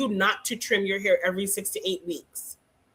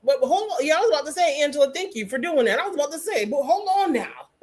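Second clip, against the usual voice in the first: normal talking voice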